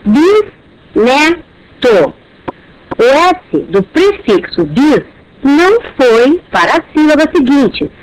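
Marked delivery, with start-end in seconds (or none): 0.1-2.1 "bisneto" drawn out as bis-ne-to; slow syllable split. Shown as a demonstration. ("bisneto" lento, bis-ne-to)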